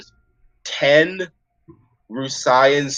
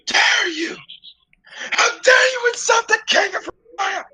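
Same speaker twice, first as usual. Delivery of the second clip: high, loud royal outrage, screaming at the party; furious royal screech at the party; outraged shout; 5 dB louder than usual. Acted. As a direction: shriek it like an insulted king. GM voices outraged elven king.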